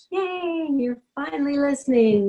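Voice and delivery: high, bright burst of joy, imitating the late dog Winston; excited dog. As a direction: squeal it like a happy dog finally heard. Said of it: "He was like".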